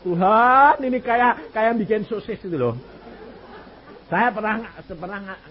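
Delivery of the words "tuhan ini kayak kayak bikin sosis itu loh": loud, high exclamation to God; loud, high exasperated outcry; exasperated cry. Retold or imitated. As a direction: throw it up to heaven in exasperation. Exclaiming about endless intestines.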